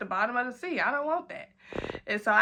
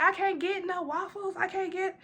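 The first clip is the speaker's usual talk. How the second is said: higher, softer tipsy whine; whiny drunk plea; 8 semitones higher, 4 dB quieter than usual. Retold or imitated. Begging staff for waffles, high tipsy whine. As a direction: whimper it like a sad drunk child.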